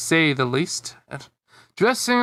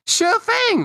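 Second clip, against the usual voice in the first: very high, squeaky reply; tiny cartoon pea chirp; little French peas. A narrator's reading. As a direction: pipe up eagerly in a tiny high voice. The peas answering brightly.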